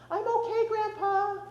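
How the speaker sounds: put-on high, slow little boy echo; high sweet voice. A narrator's reading. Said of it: "in his high, sweet voice".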